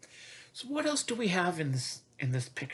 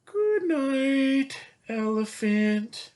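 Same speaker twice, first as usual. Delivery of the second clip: zookeeper voice; 10 semitones higher, 4 dB louder, much slower than usual. Pretend. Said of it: "he says".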